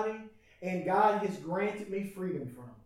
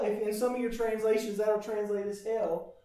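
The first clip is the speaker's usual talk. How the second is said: usual speaking voice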